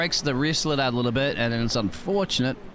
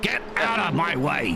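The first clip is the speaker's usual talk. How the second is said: higher-pitched mock-angry yell; 7 semitones higher than usual. Commentator voicing the angry face on the car, mock-angry cry. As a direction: play an angry little car face, stretching the repeat. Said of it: "with a little angry going".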